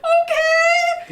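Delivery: very high, loud mock-scared squeal; imitated terrified yell. Ten-year-old answering grandpa's yelling from the tree, panicked high shout. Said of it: "But I was terrified".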